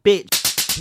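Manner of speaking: a breathy, sharp "Bitch" aimed at the operator; imitating what she wanted to say to the operator; mock outburst. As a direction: spit it out in exasperation.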